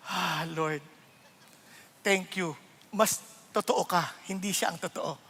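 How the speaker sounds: gently answering God instead of the insulter; calm prayer. Acted. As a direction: let the insult slide off, quietly. Calm reply to insult, praying.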